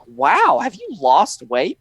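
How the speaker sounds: high-pitched imitation of surprise; mock amazement. Friends reacting to actor's weight loss, mock amazement. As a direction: act out an amazed question.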